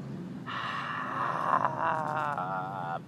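very long, sustained sighing breath; empty the lungs in one long sigh; drawn-out yoga out-breath; demonstrated exhale. Shown as a demonstration.

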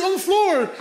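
high, loud disbelief; mock incredulous. Driver to cholos discussing the injured man, high incredulous yelp. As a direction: yelp it at them in mock alarm.

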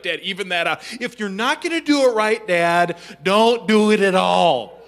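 mock-stern daughter, slowing on the last words; bossy child coach. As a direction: boss dad around like a tiny critic. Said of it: "she's coaching me the whole time".

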